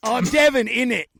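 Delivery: loud, fast mock English accent; cockney "innit" impression. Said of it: "stop doing English accents".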